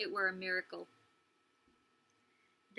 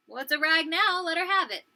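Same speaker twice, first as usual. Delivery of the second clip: dismissive; 5 semitones higher, 6 dB louder than usual. Acted. Big sister dismisses the protest.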